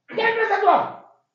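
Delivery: high, loud scornful snap; angry retort. Acted. Landlord scoffing at the son-in-law's parking claim, angry. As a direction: shoot back with scorn.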